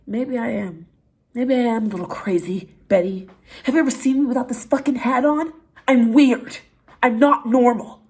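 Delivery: building to loud, high mock outburst; hammed-up loud confession.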